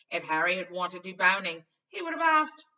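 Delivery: mock the teacher's blunder loudly; indignant Ron. A narrator's reading. Ron scoffing at Lockhart to Hermione, loud sarcasm.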